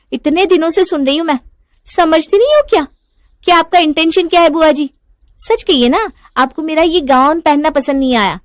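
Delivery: high, loud indignant confrontation; angry outburst. Acted. बहू का बुआ सास से झगड़ा. (ग़ुस्से में ऊँची आवाज़ में)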